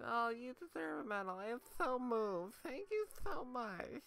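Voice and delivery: high, sugary sarcastic gushing; mock gushing voice. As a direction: ham up a fawning thank-you. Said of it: "It's a copy of".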